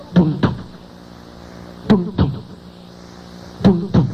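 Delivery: low, soft double thump; imitated heartbeat. Doctor hears a heart in the morgue, low imitated thumping. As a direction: mimic a heartbeat in low, evenly spaced beats.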